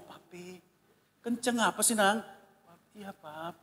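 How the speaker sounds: almost inaudible, feather-soft daughter's voice; barely audible soft voice. Retold or imitated. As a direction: speak so softly it nearly vanishes. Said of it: "Lembut banget suaranya, hampir nggak terdengar".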